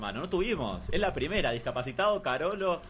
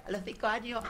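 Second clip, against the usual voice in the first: put-on little girl voice; 6 semitones higher than usual. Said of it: "habla como nenita".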